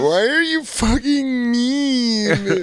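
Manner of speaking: put-on high, slow drunken whine; drunk yelling outside. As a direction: stretch it out, high and hurt.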